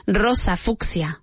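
normal talking voice

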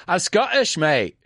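odd pause before Scottish, high; Scottish with a strange little pause; light Scottish accent with a pause. Shown as a demonstration. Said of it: "Hay que hacer esa pausa rara".

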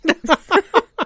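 a sudden high laugh, real and unforced; bright burst of laughter. Real, not acted. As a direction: laugh out loud at the joke. Laughs at Galician friends remark. (risa espontánea y aguda)

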